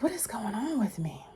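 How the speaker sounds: soft unvoiced whisper; whispered worry. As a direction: whisper it to yourself, worried. Quoting herself, worried.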